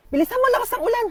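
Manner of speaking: high-pitched urging; urgent hurrying. Acted. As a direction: hurry someone along in a high voice. Hurrying sibling out of rain.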